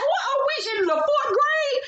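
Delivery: high squeaky disbelief, slowed down; mock outrage. Reacting to Nene jumping at Kenya, high mock disbelief. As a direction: scoff at grown women acting like kids. Mocking the fight as childish.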